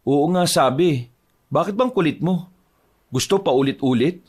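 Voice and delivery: annoyed at being pestered; irritated. Acted. Husband brushing off his wife's questions, irritated.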